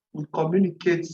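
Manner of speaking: normal talking voice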